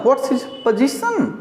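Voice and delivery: high, slow, mocking "What's his position?"; mock wrong question. Shown as a demonstration. Teacher mocks the students' wrong question high. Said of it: "ऐसा नहीं बोलना है".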